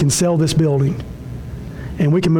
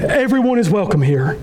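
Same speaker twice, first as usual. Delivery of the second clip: ordinary talk, nothing marked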